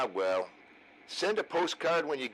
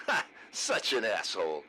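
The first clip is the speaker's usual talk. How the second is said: ordinary talk, nothing marked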